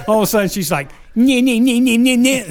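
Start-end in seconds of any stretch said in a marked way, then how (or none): 1.1-2.5 high-pitched nyeh-nyeh jabber; mock scolding in a raised voice; mocking gibberish rant. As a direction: imitate her angry chatter as nonsense, high up. Mocking the angry caller's rant.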